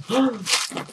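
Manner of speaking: quick high intake of breath; excited gasp. Real, not acted. Vlogger spotting the next item, delighted gasp. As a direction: gasp at the find.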